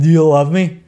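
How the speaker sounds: a hint of a drunken slur; half-acted tipsy question to a drinking buddy; lightly voiced drunk. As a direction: play it mildly drunk. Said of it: "one drunken person say to another drunken person".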